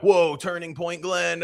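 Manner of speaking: high-pitched imitation of jeering critics; mock accusation. Mimicking the chat's accusing reaction.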